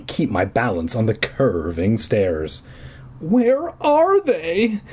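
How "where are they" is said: put-on high, slow, drawn-out nervous boy; nervous demand. A narrator's reading. Eddie, lost on the stairs, demanding high and nervous. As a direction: stretch it out anxiously. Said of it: "Eddie demanded nervously".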